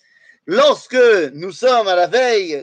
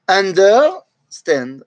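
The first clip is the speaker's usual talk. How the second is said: Understand stretched very slowly and a bit louder; slow, stressed English; 4 dB louder, much slower than usual. Shown as a demonstration. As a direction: drive Understand home slowly. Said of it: "littéralement".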